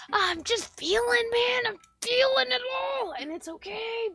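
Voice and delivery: high, loud, emotional wail; overwhelmed cry. Real, not acted. Overwhelmed by feeling it all, loud wail. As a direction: cry it out at full voice.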